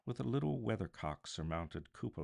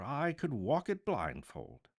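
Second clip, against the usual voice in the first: eager, raised and high; fervent; 7 semitones higher, 3 dB louder than usual. A narrator's reading. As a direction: say it with fervor. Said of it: "cried Scrooge with fervor".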